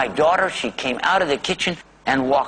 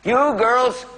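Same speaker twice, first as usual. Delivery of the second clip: mock scolding shout; 10 semitones higher than usual. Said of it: "I'm gonna say".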